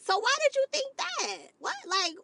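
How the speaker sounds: high incredulous question. Retold or imitated. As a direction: ask it in high disbelief. Mocking disbelief at the date.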